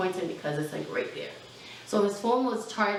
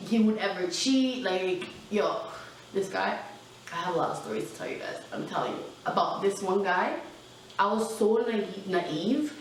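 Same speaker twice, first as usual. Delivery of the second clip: ordinary talk, nothing marked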